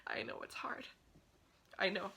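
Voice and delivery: breaking, barely voiced, near tears; fighting back tears as she says it; choked up. Real, not acted. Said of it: "Gonna make myself emotional".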